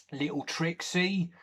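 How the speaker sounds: usual speaking voice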